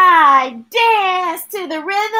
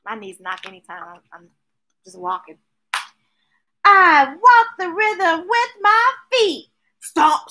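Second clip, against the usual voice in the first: plain reading voice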